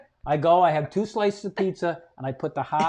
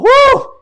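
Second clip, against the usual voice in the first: loud, high whoop; big exhaling "whoo" of relief; re-enacted cry of relief, pitched way up; relieved whoop; 12 dB louder than usual. Relieved whoop leaving the bathroom.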